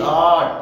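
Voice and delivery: आर्ट with a long drawn aa and no r sound; long vowel, silent r. Shown as a demonstration. Teacher showing students the long vowel, r unspoken. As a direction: stretch the aa in आर्ट, drop the r. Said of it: "सिर्फ दीर्घ, दीर्घ कर रहा हूँ".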